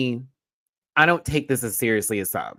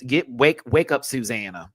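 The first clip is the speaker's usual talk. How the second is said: normal talking voice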